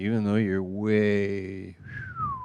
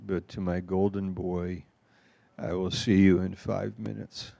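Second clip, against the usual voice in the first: ordinary talk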